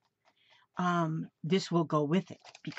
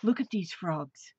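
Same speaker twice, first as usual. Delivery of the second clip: usual speaking voice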